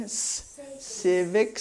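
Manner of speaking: civics with a clear S hissed at the end; final S. Shown as a demonstration. Teacher drilling the final S of civics for students. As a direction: sound the S in civics. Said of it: "You have to put the S on the end".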